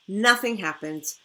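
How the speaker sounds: ordinary delivery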